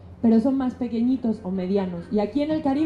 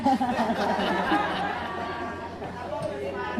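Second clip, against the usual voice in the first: laugh; 2 semitones lower, 6 dB quieter than usual. Real, not acted. Laughing after partner's tease.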